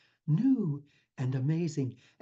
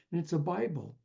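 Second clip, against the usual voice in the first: ordinary talk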